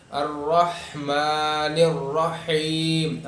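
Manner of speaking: very slow, elongated الرحمن الرحیم; stretched Quranic recitation, both ح distinct; big ha recitation. Shown as a demonstration. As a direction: sound each ح from the throat in الرحمن الرحیم. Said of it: "اور یہاں پہ بھی بڑی ہا ہے".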